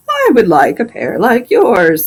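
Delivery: a grumbling plow horse, slow in places; a fed-up horse complaining about his vet; talking horse. Acted. Plow horse complains to the doctor about the vet, grumbling horse voice.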